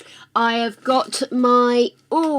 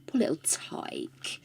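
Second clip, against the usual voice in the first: soft, unvoiced sympathy; whispered pity; 5 semitones lower, 13 dB quieter, slower than usual. Real, not acted. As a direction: breathe it out tenderly.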